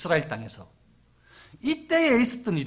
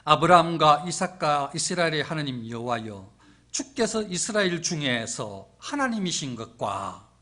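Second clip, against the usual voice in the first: solemn, declaimed invocation; Elijah's prayer; 3 semitones lower, 4 dB louder than usual. Acted. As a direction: proclaim it to God in measured, stately phrases. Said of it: "엘리야가 탁 나서서 이렇게 기도하는".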